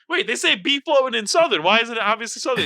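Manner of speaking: excited realization. Retold or imitated. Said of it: "I was like".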